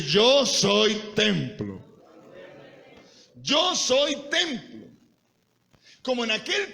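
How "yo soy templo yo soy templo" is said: slow, forceful, stretched-out proclamation; very loud declaration. Shown as a demonstration. Preacher asking the congregation to declare it very loudly, slow forceful shout. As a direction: belt out each word for the crowd to echo. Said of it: "bien fuerte y decir".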